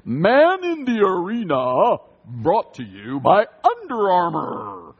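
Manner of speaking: slow, drawn-out, over-the-top promo announcer; hammed-up series announcer impression; annoying announcer voice. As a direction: do an irritating announcer, stretching out every word. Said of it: "the most annoying announcer voice".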